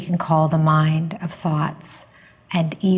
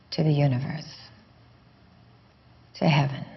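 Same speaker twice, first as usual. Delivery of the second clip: guided meditation. Real, not acted. Teacher guiding listeners to let the question go, guided meditation. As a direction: ease them gently inward, leaving space after each phrase.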